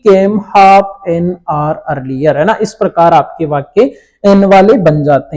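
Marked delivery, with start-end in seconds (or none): 0.1-2.4 very slow, word by word, for copying; crawling dictation pace. Shown as a demonstration. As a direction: dictate each word so the class can write it. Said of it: "लगा देंगे बच्चों N का प्रयोग".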